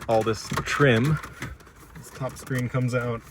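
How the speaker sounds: ordinary talk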